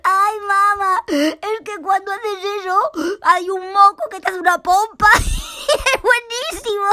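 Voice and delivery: high, loud speech shaking with laughter; can't hold the giggles in any longer; bursting out laughing. Acted. Paco estalla de risa por el moco. (Paco estalla a carcajadas, agudo y fuerte)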